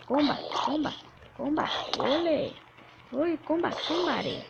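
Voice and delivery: frantic loud calls mid-fight; being chased. Real, not acted. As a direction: yell in the heat of the fight. Fighting off mobs, panicked calls.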